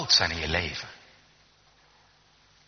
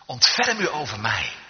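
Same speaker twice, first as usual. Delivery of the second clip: raised, high imploring cry; calling out to the Lord; 7 semitones higher, 6 dB louder, slower than usual. Acted. Blind man begging the Son of David for sight, calling out. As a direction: cry out as a plea for mercy. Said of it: "Dan mag je hem aanroepen".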